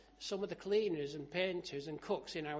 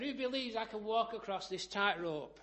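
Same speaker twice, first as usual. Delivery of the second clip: raised, higher storyteller's call; calling to a crowd; 5 semitones higher, 6 dB louder than usual. Retold or imitated. Tightrope walker challenging the crowd below, calling out. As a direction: throw the challenge out to onlookers.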